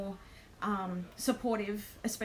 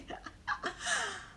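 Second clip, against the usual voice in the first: high laugh; 11 semitones higher, 4 dB quieter than usual. Real, not acted. Laughing at her own misfortune.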